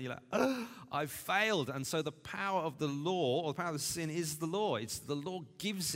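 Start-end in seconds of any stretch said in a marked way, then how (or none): 0.3-1.7 high, louder mock despair; exasperated, hammed-up self-reproach; exasperated groan. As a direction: groan it out as an acted admission of defeat. Acting reader defeated by commandments.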